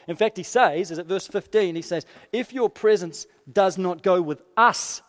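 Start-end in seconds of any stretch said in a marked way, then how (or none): 2.3-5.0 "us" drawn out and punched at the end; slow plea landing hard on "us"; stress on US. Acted. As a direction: lean heavily on the final "us". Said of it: "you hear the emphasis on us?".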